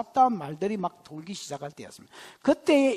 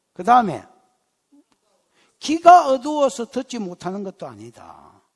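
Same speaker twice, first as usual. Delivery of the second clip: normal talking voice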